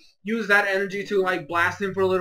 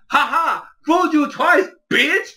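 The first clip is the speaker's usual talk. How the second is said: a little higher and louder, smug and teasing; hammed-up victory taunt; mock gloating taunt; 6 semitones higher, 4 dB louder than usual. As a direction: gloat like a sneaky villain. Villain gloats after tricking him.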